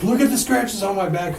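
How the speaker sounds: hammed-up scared ghost hunter; spooked ghost hunter. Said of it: "it was like".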